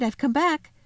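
put-on high, eager child's voice; excited announcement. Lucy telling the others she is back, excited. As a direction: announce it with a rising lilt.

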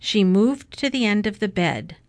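plain reading voice

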